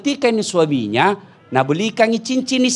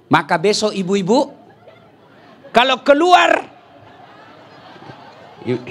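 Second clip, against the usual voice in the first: ordinary delivery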